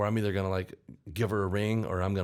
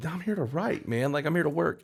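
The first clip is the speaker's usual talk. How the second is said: higher-pitched, mock-exasperated objection; indignant protest; 8 semitones higher than usual. Songwriter protesting to hungover co-writers, indignant. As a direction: object like the only serious one in the room.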